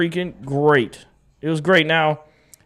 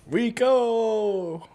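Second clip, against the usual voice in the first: hype call; 6 semitones higher, 4 dB quieter than usual. Real, not acted. Hyping the running back.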